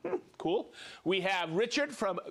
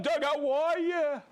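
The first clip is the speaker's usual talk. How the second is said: drawn-out, higher, louder hello; hearty greeting; 8 semitones higher, 4 dB louder than usual. Real, not acted. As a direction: call out to Doug warmly across the room.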